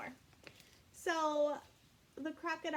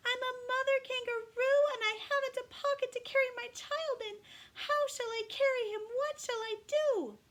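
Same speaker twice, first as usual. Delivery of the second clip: very high, loud yell; screaming up at owl; 13 semitones higher, 7 dB louder than usual. Acted. Katy screaming up at the deaf owl, high and loud. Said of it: "screamed at him".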